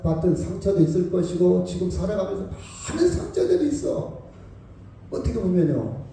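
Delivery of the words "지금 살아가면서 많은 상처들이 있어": ordinary talk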